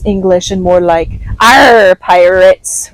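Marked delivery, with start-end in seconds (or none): 1.4-2.9 loud growled pirate "Arr" with hard R. Pretend. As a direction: belt it like a pirate, rolling the R. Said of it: "rhotic R's ... with the hard R".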